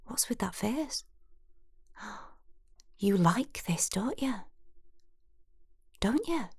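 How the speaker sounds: Jackie's teasing whisper, rising to a high, needling question; taunting whisper. A narrator's reading. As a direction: whisper it close, goading her. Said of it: "then she said".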